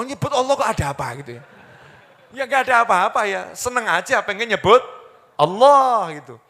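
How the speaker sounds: ordinary talk, nothing marked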